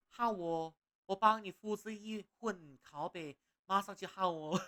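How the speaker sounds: put-on high, loud, chirpy Malaysian-accented shopkeeper fish; Malaysian-accent fish merchant eager to make a copy. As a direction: bounce each 好哦 in a cheerful Malaysian accent. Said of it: "That's just a Malaysian accent".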